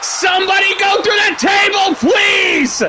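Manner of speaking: high shout, leaning on through in somebody go through that table; desperate fan shouting at the game; excited pleading shout. Real, not acted. Begging for a table spot.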